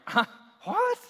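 high, baffled double take; startled listener. Pretend. Congregation reacting to the pastor's insult, startled. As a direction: squeak out confused disbelief. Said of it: "You're like".

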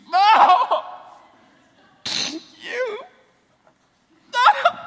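mock bawling wife, shrieked high through laughter; mock ugly cry. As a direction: overplay a blubbering sob for laughs. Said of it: "It's this really ugly cry".

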